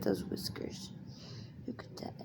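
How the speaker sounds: whispered; hushed. Real, not acted. Owner whispering over her cat's whiskers. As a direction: breathe it softly so the cat stays calm.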